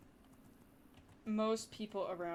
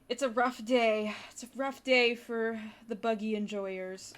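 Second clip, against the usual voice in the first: laughing; 3 semitones higher, 8 dB louder, slower than usual. Real, not acted. Laughing at losing side of poll.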